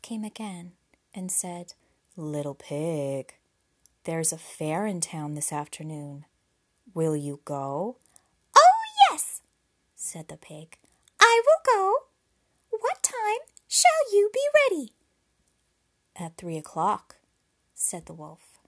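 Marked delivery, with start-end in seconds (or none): 2.2-8.0 wolf voice. Acted. Wolf speaks to the pig. 8.5-9.4 very high, loud little pig. Acted. Pig answers the wolf. 11.2-14.9 very high, loud little pig. Acted. Pig answers the wolf. 16.1-17.2 wolf voice. Acted. Wolf answers the pig.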